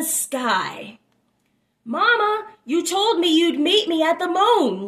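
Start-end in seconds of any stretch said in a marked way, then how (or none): 1.8-4.9 put-on high, louder childlike call; a lost calf reaching for its mother; little one calling. A narrator's reading. Child calls up to the moon, high and longing. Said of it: "little one said".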